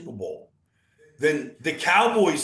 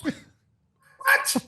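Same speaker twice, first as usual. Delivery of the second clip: high incredulous what. Real, not acted. Disbelief at schedule.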